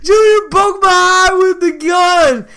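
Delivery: put-on high, wailing child tattling to mom; mock bawling. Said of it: "like, bawling".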